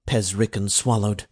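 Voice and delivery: usual speaking voice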